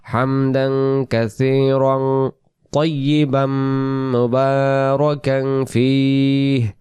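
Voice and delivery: very slow, sustained chant on an even tone; drawn-out model du'a; prayer recitation. Shown as a demonstration. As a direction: stretch each word's vowels and nasal endings. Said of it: "দোয়া হিসাবে আমরা এটা বলবো".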